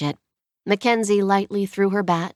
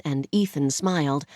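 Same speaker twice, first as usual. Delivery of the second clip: ordinary delivery, nothing marked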